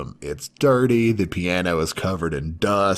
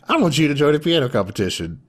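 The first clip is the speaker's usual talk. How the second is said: higher, quick eager demand; 7 semitones higher, much faster than usual. Retold or imitated. Girl demanding the boy enter a competition, higher and quick. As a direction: rush out the request.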